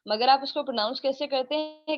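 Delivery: ordinary talk, nothing marked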